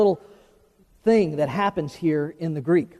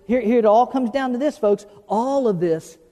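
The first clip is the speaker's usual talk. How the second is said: ordinary delivery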